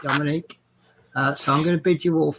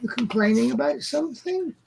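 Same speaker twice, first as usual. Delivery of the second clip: high, rising pet-talk question; high-pitched greeting to a pet; talking to cat; 8 semitones higher than usual. Real, not acted. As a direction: ask the cat in a high lilting voice.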